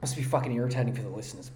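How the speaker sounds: usual speaking voice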